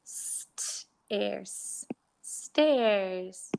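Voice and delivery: "st-" unvoiced, then "airs", then "stairs" blended; sounding out. Shown as a demonstration. Teacher sounding out words for pupils, segmenting. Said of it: "Next one is".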